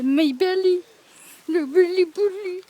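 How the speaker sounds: shouting out, loud and playful; loud silly yelling. Real, not acted. Sun on belly, silly yelling.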